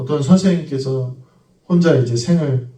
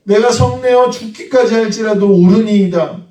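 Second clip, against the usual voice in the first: Jonah's line repeated, higher and insistent; defiant Jonah; 8 semitones higher, 6 dB louder than usual. Acted. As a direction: hammer home 성내어 with stubborn pride. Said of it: "요나가 이렇게 대답합니다".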